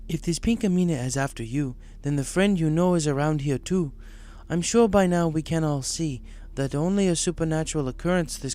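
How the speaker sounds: prophesy the danger; Zecora voiced. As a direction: mystic zebra.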